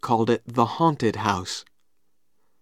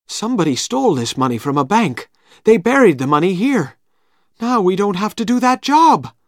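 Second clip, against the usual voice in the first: rising higher and louder, rushing; building excitement over found gold; excited stranger; 8 semitones higher, 5 dB louder than usual. Acted.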